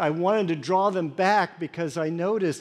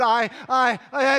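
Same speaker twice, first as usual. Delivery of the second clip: stammering; 10 semitones higher, 6 dB louder than usual. Acted. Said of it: "I stammered".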